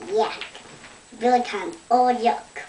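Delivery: ordinary delivery, nothing marked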